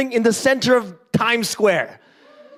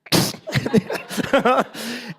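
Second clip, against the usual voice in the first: mock big laugh; 4 semitones higher than usual. Tiger laughs at mouse.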